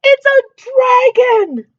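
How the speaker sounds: very high, loud warning; king alarmed at the dragon. Acted. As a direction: cry out in fright.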